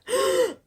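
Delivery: sharp, high theatrical gasp; a hammed-up gasp of shock; mock shocked gasp. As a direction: gasp like she's scandalized. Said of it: "Heather's like".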